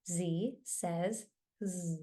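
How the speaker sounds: first Z named zee, last Z a held zzz; soft, spaced Z says Z, ending in a buzz; name the first Z, buzz the last Z; letter and sound. Shown as a demonstration. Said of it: "saying the letter and the sound together".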